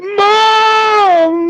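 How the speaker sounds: put-on scared kid yell. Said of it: "And the kid's like".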